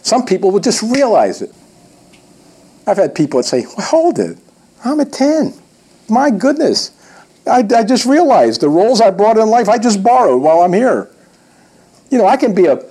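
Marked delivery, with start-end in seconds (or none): 3.7-11.1 higher-pitched dawning discovery; acted sudden realization. Trainer voicing people who just realized their worth, acted amazement. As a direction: voice lifted as it all clicks.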